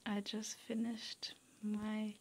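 usual speaking voice

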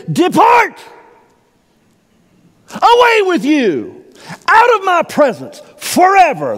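booming, very high voice of God casting out; a judge's condemning roar; God's voice. Acted. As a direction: thunder the judgment as the Lord.

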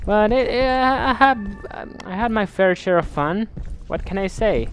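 squeaky-high, hesitant admission; high-pitched concession. Acted. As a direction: say it high, as if defending a weak verdict. Half-hearted defence of game.

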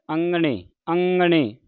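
ਅੰਗਣੇ with a nasal ng through the nose; nasal pronunciation demo. Shown as a demonstration. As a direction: send the ng of ਅੰਗਣੇ from throat into nose. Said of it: "ਇਸ ਦਾ ਉਚਾਰਨ ਹੈ".

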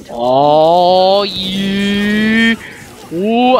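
long, loud rising whoop; loud, sustained gloating cry; drawn-out cheer. Real, not acted. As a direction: stretch it out into a big cheer. Celebrating in game.

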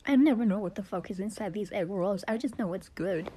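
usual speaking voice

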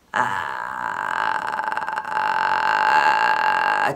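deep, low creaky rattle; long creak on the vowel; vocal fry. Shown as a demonstration. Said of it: "ってやつですね".

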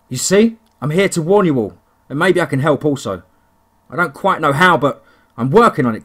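rally the room urgently. Acted. Narrator warns the bar.

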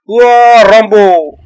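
loud high war whoop; battle cry. Real, not acted. Gamer charging the monster, loud whooping battle cry. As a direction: charge in yelling like Rambo.

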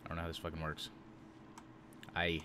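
ordinary talk, nothing marked

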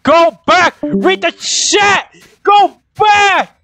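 shrill yelled go back; frantic retreat order. Real, not acted. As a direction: yell at them to pull back, now. Player yelling at teammates to retreat.

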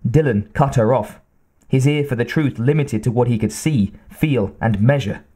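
plain reading voice

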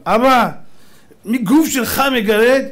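loud, drawn-out reproachful question; Persian father scolding. Acted. Father asks his paratrooper son if he is itching, scolding. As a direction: scold the son loudly and slowly. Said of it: "אבא שלו היה בא אליו".

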